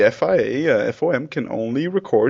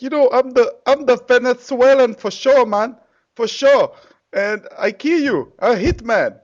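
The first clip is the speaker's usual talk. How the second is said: high, loud, hammed-up Venezuelan accent drifting towards Arabic; botched Venezuelan accent; 13 semitones higher, 6 dB louder than usual. Mimicking a Venezuelan driver boasting to his rival, badly botched accent. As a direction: do a bad, over-the-top Venezuelan accent. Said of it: "I suck at accents".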